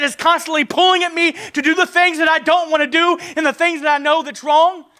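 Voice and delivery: loud, high, driving sermon voice; preaching at full force. Acted. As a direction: pour it out over the church, pleading. Preaching to the congregation.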